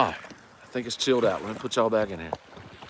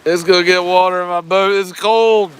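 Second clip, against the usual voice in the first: half-mock alarm; 11 semitones higher, 8 dB louder than usual. Real, not acted. Waves washing toward his kayak.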